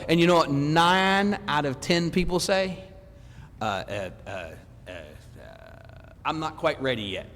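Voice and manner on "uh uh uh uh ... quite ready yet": low, soft stammering excuse; mock hemming before backing out; mumbled ums, then a sheepish excuse; reluctant hesitation. Imitating people dodging the offer.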